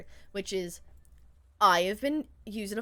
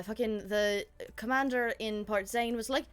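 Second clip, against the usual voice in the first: ordinary delivery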